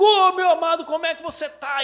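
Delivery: high, loud delighted hello; joyful greeting. Retold or imitated. Pastor greeting a long-lost friend, delighted and loud. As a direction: greet an old friend with joy.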